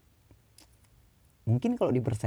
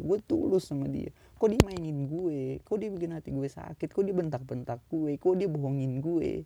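tearful complaint to God; 9 semitones higher than usual. Retold or imitated. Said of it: "lo cuma bisa nangis".

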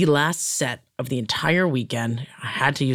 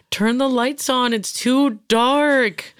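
high, drawn-out wail; high call with a long-held final word; mock calling out; 11 semitones higher, 4 dB louder, much slower than usual. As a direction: stretch it out in a high mock plea. Acted coworkers calling out in dark.

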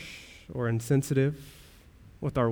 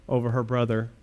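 ordinary talk

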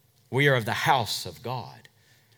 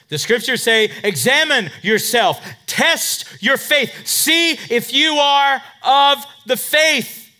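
loud, slow, preaching to the church; full preaching; 11 dB louder than usual. Acted. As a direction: call the verse out to the congregation.

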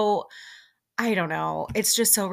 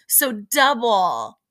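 loud, very slow mock yell; hammed-up shouted boast; retold yell; 3 semitones higher, 8 dB louder, much slower than usual. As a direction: stretch out the brag, louder. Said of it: "stands up and yells".

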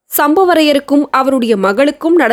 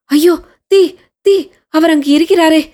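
shrieking in terror; 5 semitones higher than usual. A narrator's reading. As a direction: cry out in panic for him. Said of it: "என்று அலறிக்கொண்டு".

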